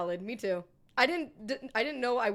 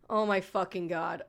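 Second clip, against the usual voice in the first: usual speaking voice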